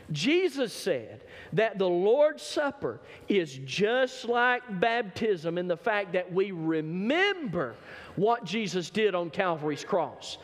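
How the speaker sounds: normal talking voice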